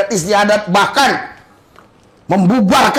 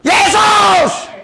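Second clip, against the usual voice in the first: shouting Jesus; 8 semitones higher than usual. Shown as a demonstration. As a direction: cry out over the crowd. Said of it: "tambah kencang lagi".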